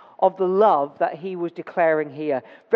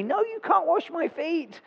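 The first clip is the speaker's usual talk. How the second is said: protesting Peter; 11 semitones higher than usual. Retold or imitated. Peter refusing to let Jesus wash his feet, high indignant protest. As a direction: squeak out a shocked refusal.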